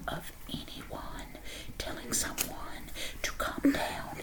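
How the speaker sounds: slow, very soft whisper; hushed build-up before an outburst. Pretend. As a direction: whisper it slowly, spacing out the phrases. Whispered setup before a yell.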